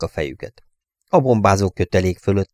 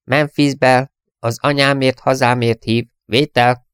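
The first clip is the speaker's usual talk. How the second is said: thin nervous voice; 5 semitones higher, 4 dB louder than usual. A narrator's reading. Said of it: "egy vékony, ideges hang".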